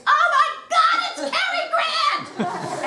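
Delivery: the mother shrieks, starstruck at the table; sudden scream. Retold or imitated. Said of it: "all of a sudden screams".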